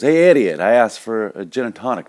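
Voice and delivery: high, loud mock outrage; angry customer. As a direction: tell the bartender off, hammed-up. Second customer berates bartender.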